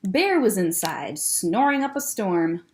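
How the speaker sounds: ordinary delivery, nothing marked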